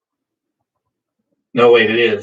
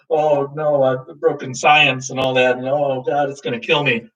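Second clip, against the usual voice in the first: ordinary talk, nothing marked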